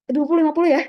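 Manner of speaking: sudden high, loud exclamation; startled exclaim. Real, not acted. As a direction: blurt it out in surprise. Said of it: "sudden exclaim". (seruan kaget, lebih tinggi dan keras)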